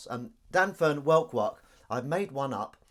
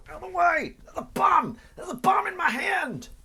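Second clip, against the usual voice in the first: very high, squealing hammed-up shout; mock film-hero panic; 12 dB louder than usual. Running through a crowd pushing people aside, hammed-up panicked shout. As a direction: scream it like an action-film emergency. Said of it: "like they do in films".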